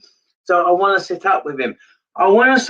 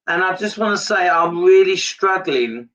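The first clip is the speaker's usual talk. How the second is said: ordinary delivery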